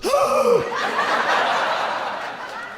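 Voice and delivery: high breathy gasp of horror; shocked gasp. Retold or imitated. High gasp as she hears his diagnosis. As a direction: gasp like bad news just landed. Woman gasps at news.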